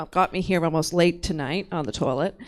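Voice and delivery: ordinary delivery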